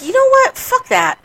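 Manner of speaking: high-pitched, defiant dismissal; fed-up retort. Retold or imitated. Griever giving up on friend who failed them, fed up. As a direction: throw it off in retold exasperation, voice jumping up. Retold angry decision about friendships.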